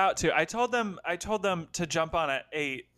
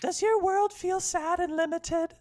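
Little Red voice; 7 semitones higher, slower than usual. Pretend. Little Red and wolf bicker.

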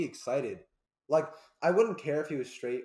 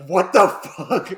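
louder, slow drawn-out yell of disbelief; shocked shout; 5 semitones higher, 16 dB louder, much slower than usual. Retold or imitated. A father hearing his son's confession, yelling in shock. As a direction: stretch it out in loud shock. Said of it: "I'd be like".

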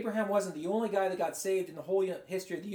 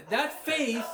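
ordinary talk, nothing marked

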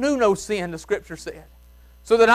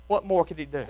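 much lower and much softer echo of the question; hushed repeat; 5 semitones lower, 8 dB quieter than usual. Acted. Preacher echoing his own question to the church, hushed and low. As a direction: let the question fall to a near hush.

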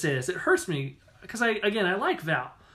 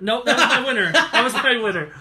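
laughing; 4 semitones higher, 5 dB louder than usual. Real, not acted.

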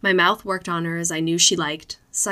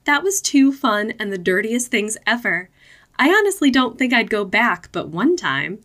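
high, loud, giddy rush; excited girlish voice; 5 semitones higher, 4 dB louder than usual. Acted. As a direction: gush like a thrilled girlfriend. Lily gushing after the store.

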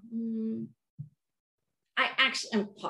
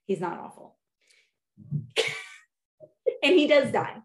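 ordinary talk

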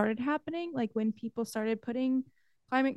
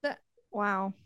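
ordinary talk

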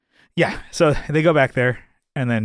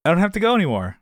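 higher, put-on excuse voice; imitated sudden reversal; 8 semitones higher, faster than usual. Jerry suddenly dropping his bathroom excuse, put-on high voice. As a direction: pitch up for a mock change of heart. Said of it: "all of a sudden, he's like".

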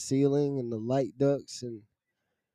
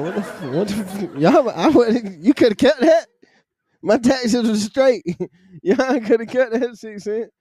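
incredulous outburst; 9 semitones higher, 6 dB louder than usual. Retold or imitated. Outraged at six-cent raise.

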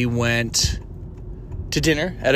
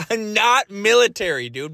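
exasperated; 8 semitones higher than usual. Retold or imitated. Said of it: "And I'm like".

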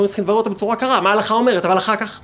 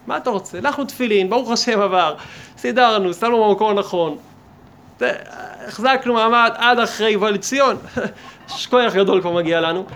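raised, louder mock defensiveness; defensive self-congratulation; 2 semitones higher, 5 dB louder than usual. Worshipper boasts he got through morning prayers, mock defensive. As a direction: act out a man insisting he has done his duty. Acting a man defending his routine.

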